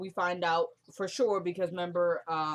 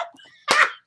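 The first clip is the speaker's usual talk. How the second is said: high burst of real laughter; loud laugh; 12 dB louder than usual. Real, not acted. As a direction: shriek with laughter. Cracking up at the bleeped swear.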